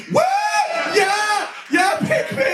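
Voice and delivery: high shouted whoops; forced cheering. Retold or imitated. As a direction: cheer on cue, overeager. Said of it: "we all went".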